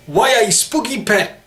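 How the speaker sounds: high, hammed-up Newcastle accent. Shown as a demonstration. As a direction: play a broad caricature Geordie. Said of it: "that real stereotype of a Geordie".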